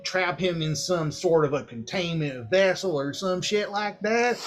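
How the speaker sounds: Southern drawl, held through the whole rant. Acted. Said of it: "is this man from Lancaster?".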